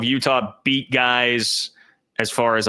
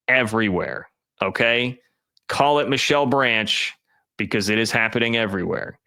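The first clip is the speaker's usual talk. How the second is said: ordinary talk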